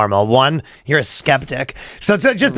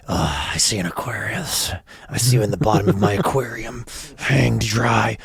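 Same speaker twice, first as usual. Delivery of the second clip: mystical horoscope reading; 4 semitones lower, 6 dB quieter than usual. Acted. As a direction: read the fortune like a threat. Sinister whispered horoscope.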